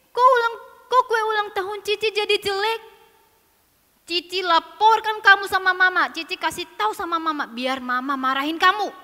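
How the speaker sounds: put-on loud, aggrieved child protest; upset tattling threat. Sister threatening to report Keke to Mama, loudly aggrieved. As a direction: threaten to tell on them.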